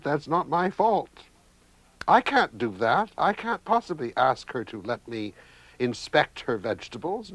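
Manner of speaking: a prim madman refusing his vegetables' pleas; crazy brother lecturing food. A narrator's reading. Crazy brother arguing with his peas, high and indignant. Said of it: "the crazy brother will suddenly say to his plate of food".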